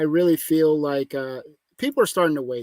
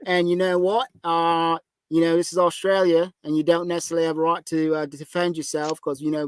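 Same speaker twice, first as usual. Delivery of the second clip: hammed-up Aussie cop saying "mate"; lightly done Australian accent; mock Australian accent; 2 semitones higher than usual. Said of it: "come to my goddamn door and say".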